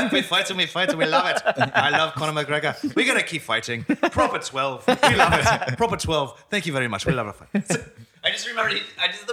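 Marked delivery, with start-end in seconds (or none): 0.1-7.5 high, loud, brash Irish brogue; Conor McGregor Irish accent. Pretend. Friend at the table hamming up Conor McGregor, in a loud Irish brogue. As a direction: boast like a fired-up Irish fighter. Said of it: "I've seen it on Conor McGregor".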